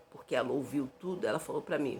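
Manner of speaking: usual speaking voice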